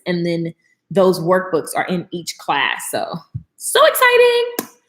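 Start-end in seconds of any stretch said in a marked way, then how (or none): 3.6-4.8 excited. Real, not acted. Excited about her course.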